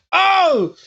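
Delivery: sudden loud high "Oh!"; a startled cry of surprise; surprised oh. Retold or imitated. Startled shout at her hype.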